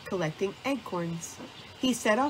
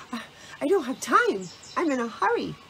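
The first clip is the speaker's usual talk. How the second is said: higher, slightly breathless protest; puffed, flustered; 6 semitones higher, 3 dB louder, faster than usual. A narrator's reading. Squirrel tugs his trolley past Owl and Fox, mildly puffed. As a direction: stammer it out as if puffed from hauling. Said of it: "puffed Squirrel".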